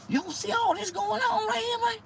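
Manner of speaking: high squeaky plea; pleading falsetto. Retold or imitated. Comedian begging his own body to respond, high and squeaky. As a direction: pitch up into a desperate squeak.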